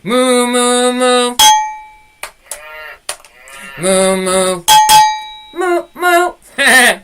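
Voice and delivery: announced triple moo, done playfully; mooing like a cow. Pretend. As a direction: moo like a cow in a cheery rhythm. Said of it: "let's do a triple moo".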